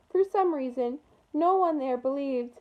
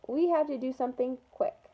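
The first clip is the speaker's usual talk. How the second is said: plain reading voice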